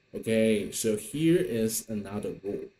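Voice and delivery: normal talking voice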